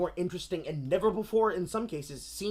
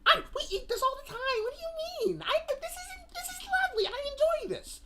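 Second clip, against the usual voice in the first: prissy high voice. Retold or imitated. Mocking the film's maker.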